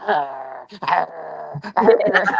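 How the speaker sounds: imitating a dog growling; poodle growl.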